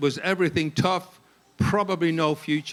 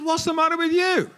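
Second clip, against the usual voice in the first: high, loud indignant comeback; retold indignant outburst; indignant retort; 12 semitones higher, 5 dB louder than usual. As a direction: throw it back high and loud.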